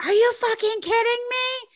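high-pitched incredulous outrage; mock-outraged yell; retold yelling. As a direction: throw it out as an indignant, high-pitched demand. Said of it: "I'm yelling at his belly button".

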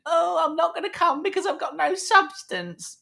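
high, put-on whiny voice mocking a friend; mocking Sam. Host mocks absent co-host's excuse in a high, whiny put-on voice. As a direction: squeak it out like a sulky excuse. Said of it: "Sam is saying".

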